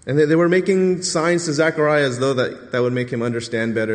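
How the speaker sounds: normal talking voice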